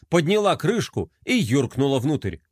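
plain reading voice

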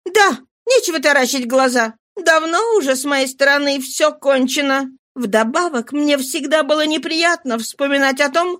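high-pitched, contemptuous woman's tirade; Nana, scolding. Acted. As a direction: dismiss the boy with irritated scorn. Voices Nana scolding Georges.

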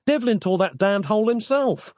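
high-pitched exasperated protest; indignant cry. A narrator's reading. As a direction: protest it in disbelief, pitch climbing. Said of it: "cried Nick".

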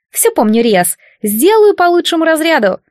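high, eager chirp; a tiny demoness eager to please; squeaky little voice. Acted. (писклявый голосок)

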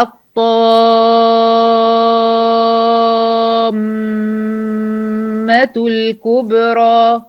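"الطامة" drawn out very slowly, holding the nasal hum on the doubled meem; nasal ghunna. Shown as a demonstration. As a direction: stretch "الطامة الكبرى" with a long, held meem. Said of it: "طب انا لما اجي اقول". (غنة كاملة على الميم المشددة في "الطامة")

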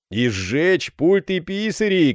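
high, drawn-out cry; shouting. A narrator's reading. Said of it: "крикнул его сосед". (протяжный выкрик)

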